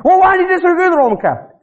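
lightly voiced pushy woman; mock interrogator. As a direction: grill them.

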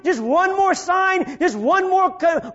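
ordinary talk, nothing marked

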